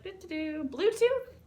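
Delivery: high, sing-song feigned innocence; playful cover-up act. Retold or imitated. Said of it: "when people would walk by, I was like".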